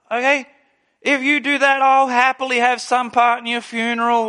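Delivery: ordinary talk, nothing marked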